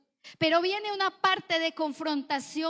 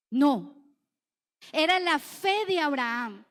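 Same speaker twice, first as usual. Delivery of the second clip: ordinary delivery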